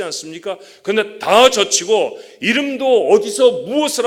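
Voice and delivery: rising sermon climax, pushed loud and high; impassioned preaching. Real, not acted. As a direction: preach it at full force to the congregation. Preaches at full force.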